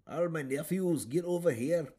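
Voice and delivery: high, loud cartoon duck-uncle call; Scrooge McDuck voice. Uncle calling his nephews over, in a high loud cartoon voice. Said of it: "when he talks to one of them".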